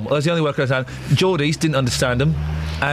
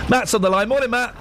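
ordinary delivery

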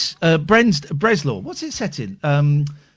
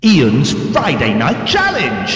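slow, louder jingle announcer; 2 semitones lower, 4 dB louder, slower than usual. Shown as a demonstration. Host demoing his show's jingle, announcer style. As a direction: boom it like a show jingle. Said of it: "it's gonna go something like this".

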